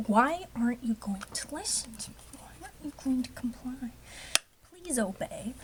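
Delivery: whispered, half-joking plea to the tape; mock pleading whisper. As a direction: coax the tape in a hushed voice.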